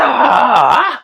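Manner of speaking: usual speaking voice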